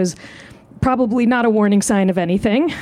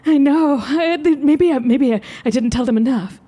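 slightly higher, fretful self-quote; mock dismay; 4 semitones higher than usual. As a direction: act out flustered regret, pitched up a bit. Reenacting own reaction in story.

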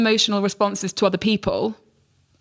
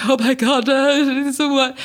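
shocked reaction; 6 semitones higher than usual. Retold or imitated. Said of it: "I'm like".

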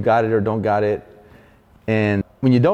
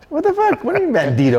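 much higher, outraged disbelief; faster than usual. Retold or imitated. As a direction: demand it in shock. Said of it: "And I'm like".

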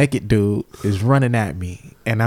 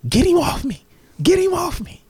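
high-pitched frantic begging, imitated; high, urgent mock plea; mock panicked plea. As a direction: imitate a panicked man pleading for help. Retells naked man's panicked plea.

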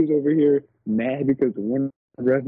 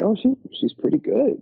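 mildly surprised; 11 semitones higher than usual. Retold or imitated. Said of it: "I was like".

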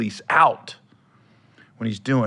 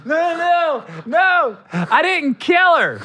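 mock protest; 13 semitones higher, 4 dB louder, slower than usual. Mock denial as accused killer.

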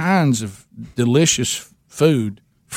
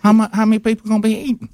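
much higher, sweet and doting; waitress voice; 10 semitones higher than usual. Retold or imitated. Waitress offering fried chicken to caller, high and doting. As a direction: dote on him like a favorite customer. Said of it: "hear her voice saying to me".

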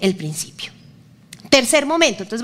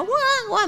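high mock wailing of a baby girl; imitated baby cry; 11 semitones higher, 3 dB quieter than usual. Father imitates his daughter's crying for the congregation, mock wail. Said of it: "Mi hija lloraba".

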